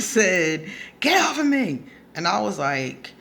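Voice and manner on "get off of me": high-pitched retold yell of protest; mock yell. Girlfriend pinned on the bed demanding release, mock yell. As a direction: imitate her hollering to be let go.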